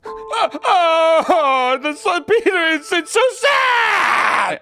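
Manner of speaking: high, loud hammed-up sobbing, stretched very slow; fake crying wail. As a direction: wail the oh my Gods like a staged cry for the camera. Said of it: "muster yourself up to cry and then set up your phone and be like".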